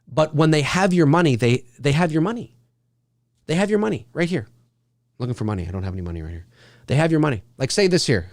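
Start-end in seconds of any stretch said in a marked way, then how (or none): 5.2-6.5 mock mumble. Acting out the moviegoer patting pockets.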